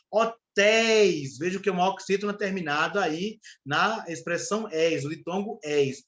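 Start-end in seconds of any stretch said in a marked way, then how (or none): 0.1-1.4 "Hotéis" slowly stretched on the open és; drawn-out example word. Shown as a demonstration. Teacher isolating an example for students, drawn out. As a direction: hold the final syllable of "Hotéis".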